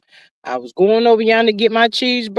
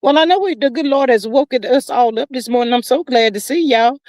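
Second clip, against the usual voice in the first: usual speaking voice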